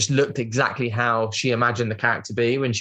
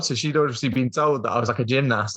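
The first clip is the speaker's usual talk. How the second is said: ordinary talk, nothing marked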